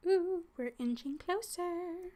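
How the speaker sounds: ordinary talk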